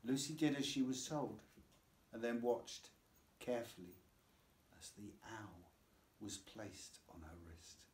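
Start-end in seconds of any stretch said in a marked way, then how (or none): none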